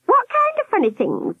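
very high probing question; sharp high-pitched nurse. A narrator's reading. Nurse probing Albert, sharp high voice. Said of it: "asked the nurse sharply".

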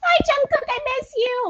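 gushing fan. Pretend. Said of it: "for me to be like".

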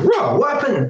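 sudden high call of alarm; startled shout. Retold or imitated. Caught off guard in his parked car, a startled shout. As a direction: jolt out a shocked question.

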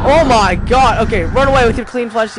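loud, high panicked yelling. Real, not acted. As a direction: scream it as you flee. Chased by monsters.

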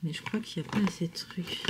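soft whisper to herself; breathy aside, barely voiced. Real, not acted. Muttering to herself while doing makeup.

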